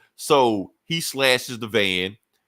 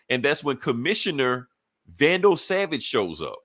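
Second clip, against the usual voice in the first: ordinary delivery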